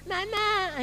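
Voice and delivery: put-on high, babyish whine; spoiled child. Mocking a friend as a spoiled kid, high babyish whine. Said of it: "masih manja".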